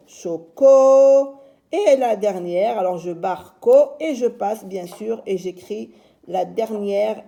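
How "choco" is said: "Choco" very loud, the "co" held long; syllabe appuyée. Shown as a demonstration. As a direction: belt out "Choco", sustaining the last syllable. Teacher repeats syllables emphatically. (« Choco » très fort, « co » tenu)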